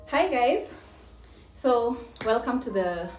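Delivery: ordinary talk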